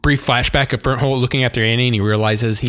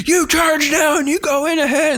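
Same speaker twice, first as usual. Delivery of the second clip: mock commander. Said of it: "And he's like".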